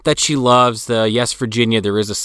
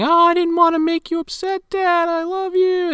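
high, mock-tearful little girl voice; imitating crying girl. Podcaster mimics the crying daughter, high and teary. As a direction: squeak it out like a weepy kid. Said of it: "she's crying".

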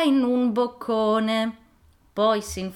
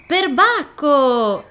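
higher, louder, slow startled cry; exclamation; 7 semitones higher, 9 dB louder, much slower than usual. Acted. The girl realises she forgot her form, a startled slow loud cry. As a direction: gasp it out, caught off guard. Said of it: "esclamò Pigiametto Rosa".

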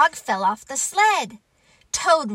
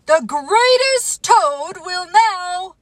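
high, loud, slow proclamation; strange announcer voice; 8 semitones higher, 8 dB louder than usual. Acted. Strange voice starting another introduction, grand announcing. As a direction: announce grandly until cut off. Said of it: "Then the voice said".

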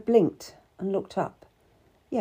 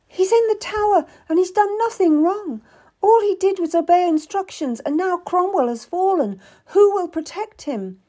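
high, loud, upset plea; distressed pleading; 12 semitones higher, 7 dB louder than usual. A narrator's reading. Tearful wife defends her imprisoned husband, distressed. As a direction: protest through distress.

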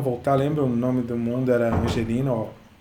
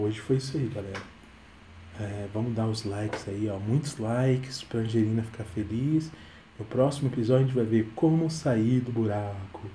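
talking softly; 3 semitones lower, 6 dB quieter than usual. Real, not acted. Dad signs off to viewers softly, baby asleep nearby. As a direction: keep it low and gentle, wrapping up. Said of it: "vou falar baixinho".

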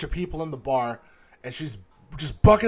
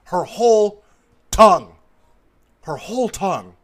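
shocked emphasis; 3 semitones higher, 10 dB louder than usual. Real, not acted. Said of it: "shocked emphasis".

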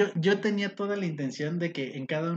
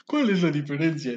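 Patrick Star voice; 3 dB louder than usual. Retold or imitated. Host quoting Patrick Star to his co-host, dopey voice. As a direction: play it dumb like Patrick Star. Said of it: "dijo Patricio Estrella".

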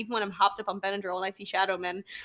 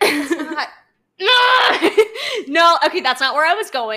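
laughing protest; 6 semitones higher, 10 dB louder, slower than usual. Real, not acted.

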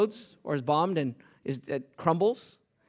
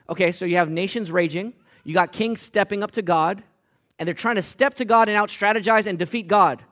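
louder, higher building sermon climax; rising preaching; 5 semitones higher, 6 dB louder than usual. Acted. Preacher telling the congregation of God's checkmate in a loud, rising build. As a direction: build the energy toward the punchline.

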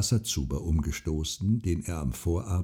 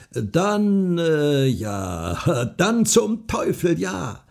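high, loud, drawn-out outburst; hesitate, then burst out with the curse; exasperated concession; 8 semitones higher, 5 dB louder, much slower than usual. A narrator's reading. Farmer gives in to the wager, exasperated.

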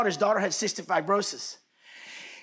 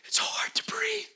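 breathless whisper; 12 semitones higher, 4 dB quieter, slower than usual. Retold or imitated. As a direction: imitate a weak, airy whisper. Voices dying person's words.

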